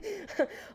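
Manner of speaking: high burst of laughter; a quick high laugh at her own joke. Real, not acted.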